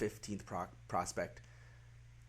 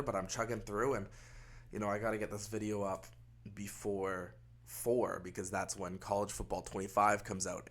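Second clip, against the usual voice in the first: ordinary delivery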